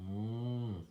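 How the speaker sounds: long, drawn-out cow moo. A narrator's reading. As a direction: imitate a cow mooing. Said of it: "Cow said".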